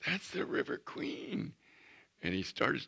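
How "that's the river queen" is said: put-on excited little boy. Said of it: "the little boy goes".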